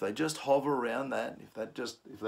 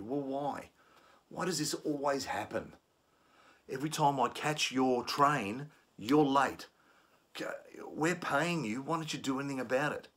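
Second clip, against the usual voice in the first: higher-pitched exasperated questioning; questioning complaint; 4 semitones higher than usual. Shown as a demonstration. Trainer acting a passenger questioning staff about late trains. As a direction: pester them with annoyed questions. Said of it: "questioning you".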